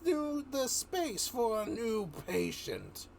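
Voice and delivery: high, slow, gleeful madman voice; villain voice. Acted. Villain gloating to the inmates, high and slow. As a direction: savor each word like a lunatic taking over. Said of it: "And he says".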